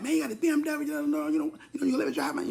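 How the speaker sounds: high pleading voice imitating his dad. Son mimics dad begging to drive, high wheedling. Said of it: "So he like".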